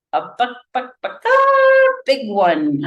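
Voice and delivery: chicken clucks slipped into speech; clucking hint. A narrator's reading. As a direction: cluck like a hen mid-sentence. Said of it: "meaningful look".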